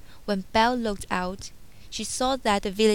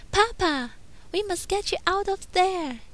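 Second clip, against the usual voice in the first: put-on high, hesitant young woman's voice; young heroine; 10 semitones higher than usual. A narrator's reading. Belle turning down Gaston's proposal, high hesitant heroine voice. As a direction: lift the voice and stumble politely.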